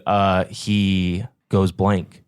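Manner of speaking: normal talking voice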